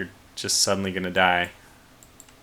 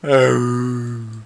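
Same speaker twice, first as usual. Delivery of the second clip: whale impression; 3 semitones higher, 7 dB louder than usual. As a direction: moan it out like a whale. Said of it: "That's my whale impression".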